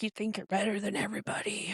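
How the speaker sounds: fast unvoiced whisper of the son's jab; whispered accusation. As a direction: hiss it quickly like a resentful son.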